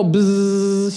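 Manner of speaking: a slow, drawn-out imitated buzz; buzzing dial tone. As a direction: hum it like a phone's dial tone. Showing the dial tone sound.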